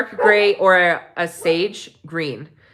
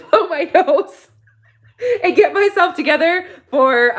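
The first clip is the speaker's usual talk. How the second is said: laughing; 9 semitones higher, 3 dB louder than usual. Real, not acted. Laughing at her own crying.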